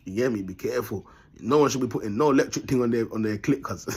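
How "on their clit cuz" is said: usual speaking voice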